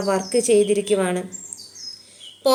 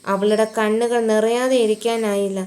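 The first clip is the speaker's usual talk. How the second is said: usual speaking voice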